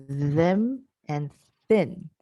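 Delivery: very slow, buzzing th in them, then airy th in thin; slow model of them and thin; voiced versus unvoiced th. Shown as a demonstration. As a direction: stretch them and thin slowly to contrast the th sounds. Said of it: "at the voiced, there's a vibration".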